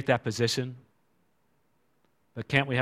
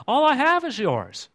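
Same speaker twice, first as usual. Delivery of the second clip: much higher, tender reassurance; gentle father; 12 semitones higher than usual. Acted. Father answering his resentful son, tender. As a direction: offer it openly like a loving father. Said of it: "the father says in response".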